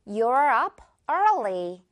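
very slow, early drawn out; slow model sentence. Shown as a demonstration. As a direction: say it slowly, stretching early. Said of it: "예문 바로 볼까요". (천천히 early 늘여서)

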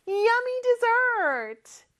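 excited gasp, then a high, slow cheer; delighted kids. Acted. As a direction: gasp and sing out like thrilled children. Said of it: "우리 아이들이".

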